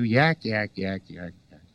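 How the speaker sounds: repetitive yakking that fades away; mock chatter. Everybody prattling at a crying baby, mock chatter.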